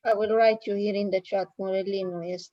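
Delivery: ordinary talk, nothing marked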